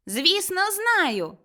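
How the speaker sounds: put-on high, confident boy's voice; little boy. A narrator's reading. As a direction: answer like a sure little lad. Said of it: "відповів малий".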